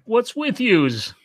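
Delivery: high, drawn-out mock New York accent. Co-host spoofing New Yorkers, exaggerated New York accent. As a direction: stretch it out as a hammed-up New Yorker. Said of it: "And saying the New York accent".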